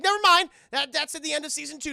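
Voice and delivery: high, loud and fast embarrassed retreat; flustered backpedal. Acted. Goliath covering an awkward remark, high and fast. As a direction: squeak it out and hurry past.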